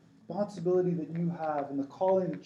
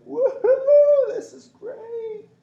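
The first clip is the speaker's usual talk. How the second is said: very high, loud joyride holler; gleeful whoop; 10 dB louder, much slower than usual. Retold or imitated. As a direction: whoop it up, thrilled by the wild ride. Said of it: "I'm laughing and I'm smiling".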